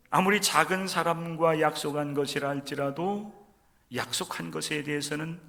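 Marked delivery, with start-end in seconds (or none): none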